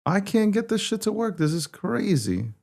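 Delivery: real helpless frustration, voice way up high; high-pitched exasperation. Real, not acted. Streamer complaining that the stream won't work, high exasperated whine. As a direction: complain high without raising the volume. Same failure, complaint repeated.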